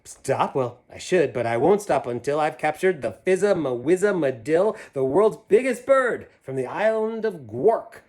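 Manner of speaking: lively rhyming storybook read; reading to children. A narrator's reading. Reading a picture book aloud to children, lively. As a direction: bounce through the rhyme for the kids. Reads Seuss rhyme aloud.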